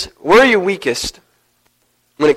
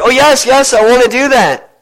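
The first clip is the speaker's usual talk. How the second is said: eager yes; 7 semitones higher, 4 dB louder than usual. Acted. Grandson eager for outing.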